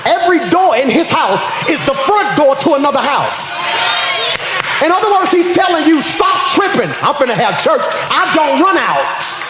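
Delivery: high, ringing sermon climax; preaching at full force. Real, not acted. Preacher telling the congregation God won't run out, full-force preaching. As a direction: drive it up to a shouted peak.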